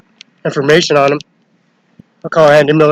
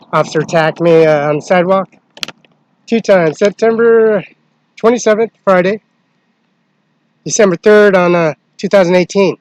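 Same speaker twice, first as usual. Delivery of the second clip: higher and louder, heated as the dates pile up; agitated raised voice; 5 semitones higher, 6 dB louder than usual. Real, not acted. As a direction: push it up in pitch and volume, worked up. Agitated complaint about being attacked.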